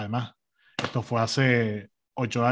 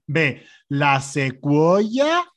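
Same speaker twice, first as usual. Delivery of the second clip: quiz host; 2 semitones higher, 6 dB louder, much slower than usual. Retold or imitated. Salesgirl reads quiz options.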